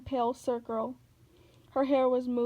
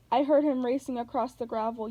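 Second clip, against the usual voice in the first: usual speaking voice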